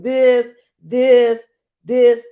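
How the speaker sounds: loud punchy repeats of this; counting off each hand shake; emphatic demo. Real, not acted. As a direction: pound out each this like a beat. Teacher showing hand motions.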